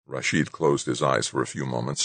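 usual speaking voice

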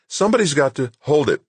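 high, emphatic snap; snapping. A narrator's reading. Doug snaps that somebody's got to hold it, snapping. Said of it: "Doug snapped".

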